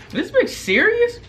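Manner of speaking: high, slow, stunned disbelief; surprised disbelief. Retold or imitated. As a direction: act like you can't believe she means it.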